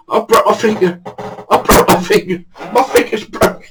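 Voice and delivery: high, pained whine; mock wailing. Victor to mate after hitting his finger, high wail. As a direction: imitate a hurt man's repeated lament. Victor hurt, voiced by teller.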